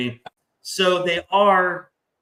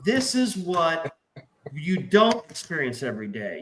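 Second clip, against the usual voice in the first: usual speaking voice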